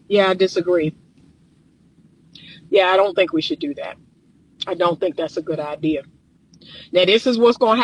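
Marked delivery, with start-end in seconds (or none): 0.1-6.0 calm stoic delivery. Shown as a demonstration. Calmly turning down a wife's request, stoic and firm. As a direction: lower and softer, level and unbothered. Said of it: "in a calm, stoic, neutral way, in a strong way that just says".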